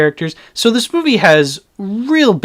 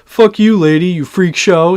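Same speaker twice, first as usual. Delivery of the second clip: higher, louder sneering mock of a jeering bully; 4 semitones higher, 4 dB louder than usual. Reviewer mocking the bullies taunting Laurie, sneering put-on voice. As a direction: ham up a dumb teen bully sneering at her. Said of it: "is just like".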